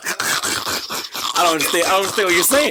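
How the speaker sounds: breathy laughing retelling, slowed; laughing through the words. Retold or imitated. Laughing as he retells telling Stanley he can't understand. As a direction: laugh while replaying his confusion. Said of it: "Why you talking like that?".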